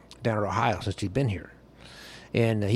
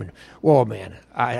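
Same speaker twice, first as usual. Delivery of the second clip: ordinary talk, nothing marked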